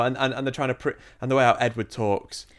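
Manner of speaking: ordinary talk, nothing marked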